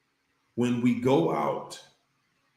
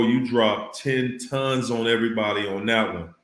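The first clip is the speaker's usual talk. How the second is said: usual speaking voice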